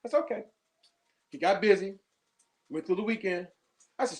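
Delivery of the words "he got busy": ordinary talk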